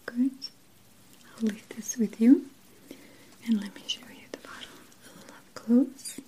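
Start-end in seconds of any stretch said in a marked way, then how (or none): none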